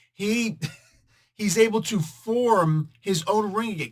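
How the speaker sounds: normal talking voice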